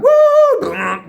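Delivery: very high, loud "woo"; long mock engine squeal; speeding bike whoosh. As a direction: mimic a bike racing up to the house.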